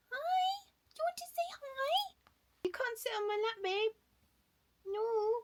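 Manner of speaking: very high, cooing little voice. Real, not acted. Talking to a little one wanting her lap, high baby talk. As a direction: sweet-talk in a squeaky-high pitch. Chatting to a pet or child.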